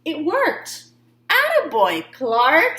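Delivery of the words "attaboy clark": louder, higher cheerful praise; praising teacher. A narrator's reading. Teacher praising Clark, cheerful. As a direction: cheer him on. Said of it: "said Missus Inky Dink".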